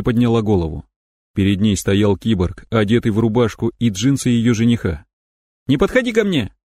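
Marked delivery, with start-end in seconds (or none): 5.7-6.5 high scared cry out; frightened cry. Acted. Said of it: "Она вскрикнула".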